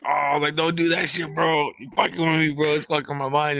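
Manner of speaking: begging him to stop messing with your head; freaked-out plea. Retold or imitated. High-strung plea to cousin who's messing with him, freaked out.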